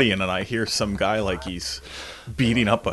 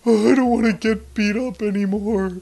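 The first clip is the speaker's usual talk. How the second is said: higher, whimpering beaten man; whiny plea; 12 semitones higher, 5 dB louder than usual. Retold or imitated. Beaten neighbor begging to stop, whiny plea. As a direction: whine like a defeated bully.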